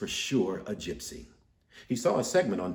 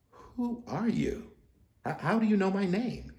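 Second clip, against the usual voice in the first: high, stammering frightened question; a hint of fear; 11 semitones higher than usual. Stanley nervously asking the psychic, with a hint of fear. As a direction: ask nervously, voice jumping up. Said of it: "a hint of fear in his voice".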